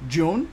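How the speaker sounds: June said as joon, with a hard J and final N; English pronunciation. Shown as a demonstration. Teacher giving learners the last month, June in English pronunciation. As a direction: model June in English, voice rising. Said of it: "That's English".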